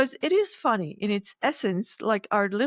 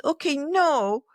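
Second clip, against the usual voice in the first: put-on childish inner voice; 7 semitones higher, 3 dB louder, slower than usual. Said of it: "I talk like this because it talks like that".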